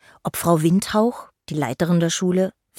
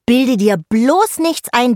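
high, loud, slow mock indignation; drawn-out indignant put-down; teasing scolding; 7 semitones higher, 7 dB louder, slower than usual. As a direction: tell him off like a haughty little fairy. Fairy scolds the troll boy.

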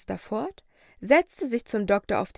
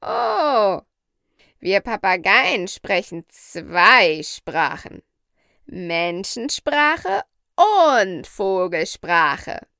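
slow, loud and proudly emphatic; proud parrot; 7 dB louder, much slower than usual. A narrator's reading. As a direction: announce it proudly, drawing out the key words. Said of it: "sagte Polynesia stolz".

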